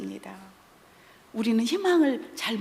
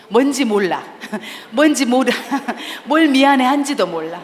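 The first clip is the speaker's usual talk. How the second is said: loud and higher, laughing between the lines; loud laughing retort; 3 semitones higher, 8 dB louder than usual. Acted. Playing a spouse who is baffled by the apology, loud laughing retort. Acting a spouse's puzzled reply.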